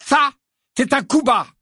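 high, loud angry accusation. Acted. Ron angrily accuses Hermione. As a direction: blast the accusation. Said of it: "dit Ron avec colère".

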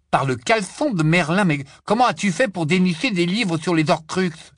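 loud, high-pitched amazed demand; astonished outburst. A narrator's reading. Ron astounded at Hermione's books, loud astonishment. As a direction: blurt it out in loud disbelief.

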